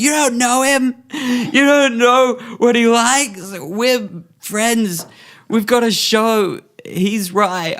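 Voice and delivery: high, loud, slow pleading; hammed-up grief. Grieving partner defending his friend to paramedics, loud hammed-up anguish. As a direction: plead tearfully and overplay it. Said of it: "And I'm like".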